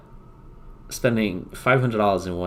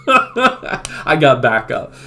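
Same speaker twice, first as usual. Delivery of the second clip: ordinary talk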